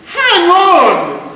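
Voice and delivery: high, loud sudden call; duck's quick cry. Acted. The duck stops the rat, high loud cry. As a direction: cut him off sharply, high and loud. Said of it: "cracked the duck".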